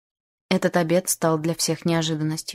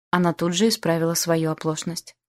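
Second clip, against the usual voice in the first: ordinary delivery, nothing marked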